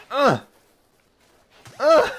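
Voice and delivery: loud, high effort grunt; throwing grunt. Real, not acted. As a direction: grunt hard as you hurl it.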